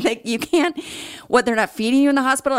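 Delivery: high, loud, fast incredulous friends; shocked friends. Retold or imitated. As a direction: blurt it out in disbelief. Friends shocked he's not fed.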